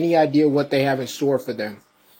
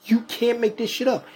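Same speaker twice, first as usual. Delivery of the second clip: pitched-up disbelief; high incredulous outburst; 8 semitones higher than usual. Real, not acted. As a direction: say it high and exasperated. Catchphrase repeated, pitched up.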